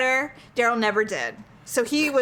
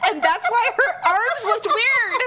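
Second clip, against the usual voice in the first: higher, laughing through the punchline; 9 semitones higher than usual. Real, not acted. Telling a friend the punchline, laughing. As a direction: let the laugh carry the words.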